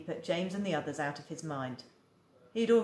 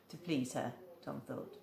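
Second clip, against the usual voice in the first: ordinary delivery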